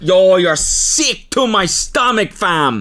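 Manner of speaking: high, slow, loud Toronto slang; a bad Toronto accent, dragged out. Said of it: "I can't even do the Toronto accent".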